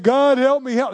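high, loud pleading cry for help; desperate cry. Pretend. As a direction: cry out to God like you're drowning. Acted desperate plea to God.

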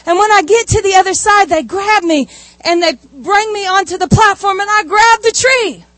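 fast, high and breathless with a laugh; tumbling out fast and high, laughing at the end; excited retelling. Retold or imitated. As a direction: relive the rush of the zip line. Retelling zip line thrill excitedly.

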